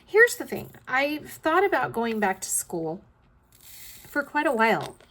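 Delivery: rough, scratchy voice; talking through a scratchy throat. Real, not acted. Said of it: "my throat's getting scratchy".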